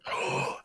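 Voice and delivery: voiceless, eager intake of breath; excited gasp. Acted. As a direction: act out an excited boy's gasp. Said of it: "as he gets all excited".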